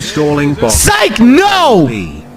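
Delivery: swooping cry of denial; mocking fake-out. Real, not acted. Streamer undoing his fake happy ending, mocking fake-out.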